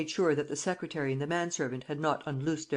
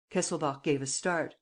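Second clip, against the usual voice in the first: usual speaking voice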